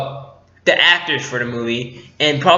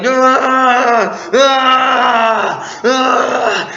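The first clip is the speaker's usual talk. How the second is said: high, drawn-out mock rage screams; pissed-off screaming; 9 semitones higher, 3 dB louder, much slower than usual. Fan imitating Kylo Ren in a tantrum, pissed-off screaming. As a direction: scream in a fit of imitated fury. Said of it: "screaming. He's pissed off".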